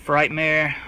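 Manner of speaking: usual speaking voice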